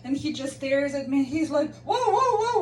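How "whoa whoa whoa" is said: startled protest. Retold or imitated. Stranger tries to stop her screaming, startled protest. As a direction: imitate someone heading off a panic.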